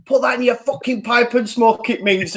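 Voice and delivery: half-hearted retold screaming at the camera; mock yell. Fan recalls screaming at the camera at a rival, mild mock yell. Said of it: "I was screaming at the camera going".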